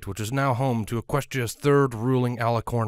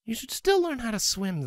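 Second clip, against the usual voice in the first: much higher playful mare voice; teasing mare; 12 semitones higher than usual. A narrator's reading. Scarlet teasing her husband, playful higher voice. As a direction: slip in the jab lightly. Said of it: "Scarlet pecked him".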